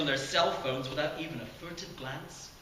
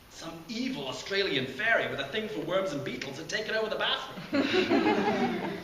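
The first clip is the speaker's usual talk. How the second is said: laughing through it; 6 semitones higher, 4 dB louder than usual. Real, not acted. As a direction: laugh as the idea gets sillier. Narrator laughing at the fairy idea.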